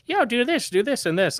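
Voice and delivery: imitating a random helper. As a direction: mimic an eager stranger pitching tips way up. Random person giving tips.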